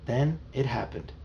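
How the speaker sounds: usual speaking voice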